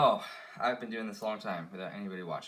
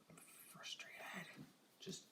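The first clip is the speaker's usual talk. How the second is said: slow whisper under the breath; drawn-out hushed complaint; slow frustrated whisper; 10 semitones higher, 18 dB quieter, slower than usual. Real, not acted. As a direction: let it out slowly in a whisper. Muttered aside while working.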